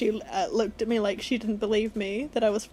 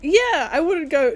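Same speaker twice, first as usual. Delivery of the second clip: ordinary delivery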